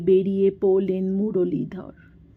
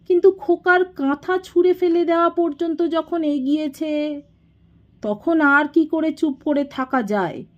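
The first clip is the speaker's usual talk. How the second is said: higher, sustained tone of grievance; aggrieved confrontation; 7 semitones higher than usual. A narrator's reading. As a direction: press the complaint in a raised pitch. Younger brother confronts elder brother. (অভিযোগের চড়া সুর)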